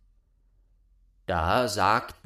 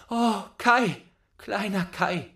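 much higher, loud yearning cry; longing sigh; 11 semitones higher, 5 dB louder than usual. A narrator's reading. Gerda longing for Kai, high yearning cry. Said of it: "seufzte Gerda".

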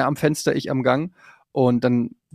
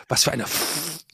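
softer, the swear word broken off at "F-"; quiet cut-off curse; 6 dB quieter than usual. Retold or imitated. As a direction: say "Was für eine F-" under your breath, stopping on the F. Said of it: "so hat sie es gesagt".

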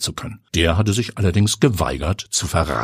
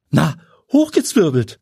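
higher, perky female voice; pert woman's voice; 9 semitones higher, 4 dB louder than usual. Acted. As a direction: toss the answer back brightly. Pia antwortet keck. (kecke Frauenstimme)

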